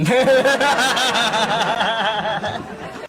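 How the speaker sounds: hearty burst of laughter. Real, not acted. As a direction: break into a long laugh. Joke about combing bald hair.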